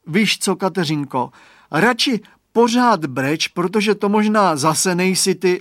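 put-on a little gardener boy reasoning with his friend; small boy. Boy's voice in story.

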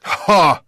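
loud, high exclamation of discovery; triumphant "ha". Acted. Headmaster spotting the fallen slingshot, triumphant. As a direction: pounce on the evidence with a sharp ha.

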